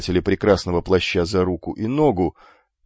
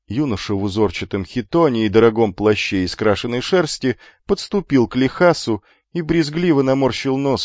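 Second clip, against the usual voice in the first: usual speaking voice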